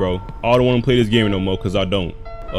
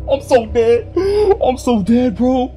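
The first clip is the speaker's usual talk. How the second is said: scared horror game streamer; 9 semitones higher, slower than usual. Real, not acted. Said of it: "I'm getting so scared".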